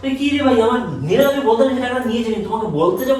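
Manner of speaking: high, exasperated protest. Acted. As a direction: throw up your hands in disbelief. Quarrel over missing money.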